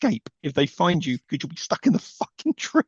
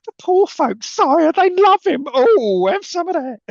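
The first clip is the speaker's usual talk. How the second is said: much higher, louder, camp overacting; hammed-up advisor; 13 semitones higher, 7 dB louder, slower than usual. Mocking the advisor's overacting, in a high camp voice. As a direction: ham it up like a fawning courtier. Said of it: "The performance of this guy".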